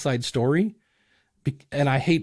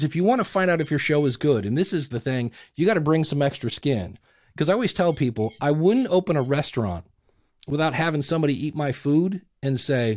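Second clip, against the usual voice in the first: ordinary talk, nothing marked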